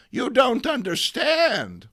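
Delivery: high, loud, slow insistence; high old lady arguing; old lady protest. Acted. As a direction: plead your case to the stranger. May argues.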